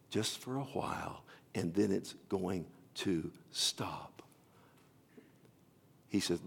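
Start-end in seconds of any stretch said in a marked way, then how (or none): 0.1-4.2 whispered explanation. Retold or imitated. Pastor explaining to Corrie, soft whisper. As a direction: whisper it gently.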